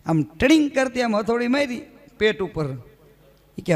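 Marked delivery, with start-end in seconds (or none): none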